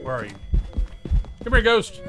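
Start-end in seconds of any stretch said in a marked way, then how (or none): 1.4-2.0 loud call to the ghost. Real, not acted. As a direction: shout for the ghost to come. Calling out to the ghost.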